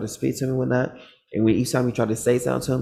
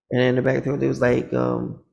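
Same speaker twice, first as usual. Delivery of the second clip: ordinary delivery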